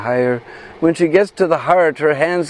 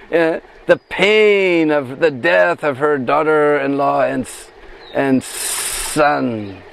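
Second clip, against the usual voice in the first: very slow, higher serpent voice with long pauses; snake voice; 3 semitones higher, slower than usual. Acted. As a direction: draw out each phrase like the snake explaining its plan. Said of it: "imitate the voice of that snake".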